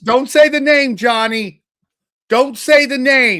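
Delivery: loud, high urgent plea; panicked hush-up yell; alarmed warning. Real, not acted. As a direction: shout him down before he says it. Friend warns against naming taboo.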